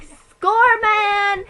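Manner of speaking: high, loud, drawn-out whoop; mock excited cheer. Caller reacting to a cleaner's booking, in a mock triumphant cheer.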